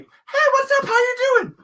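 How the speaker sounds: very high, loud squeaky hello; hammed-up high, perky greeting; chirpy friendly greeting. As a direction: imitate an over-friendly interceptor, pitched sky-high. Friend intervening, acted very high.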